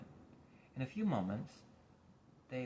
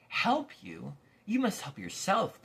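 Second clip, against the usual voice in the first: loud, high scolding; stern watchdog scolding; 10 semitones higher, 8 dB louder, slower than usual. Acted. Watchdog scolding Milo, loud stern scolding. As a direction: tell the boy off hard.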